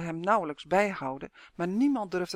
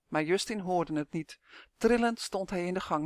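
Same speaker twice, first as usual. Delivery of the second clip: usual speaking voice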